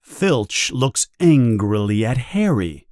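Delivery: plain reading voice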